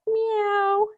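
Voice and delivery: high meow like a spooky cat; Halloween cat meow. Shown as a demonstration. Teacher finishing the cat pose for kids, high cat meow. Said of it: "like a Halloween cat".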